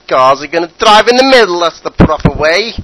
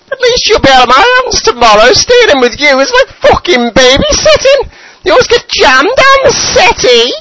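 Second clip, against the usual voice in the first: put-on high, loud, wailing old mother voice; nagging mother; 4 dB louder than usual. Mother scolding Saddam at midnight, high wailing nag. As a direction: screech it out as his fed-up mum.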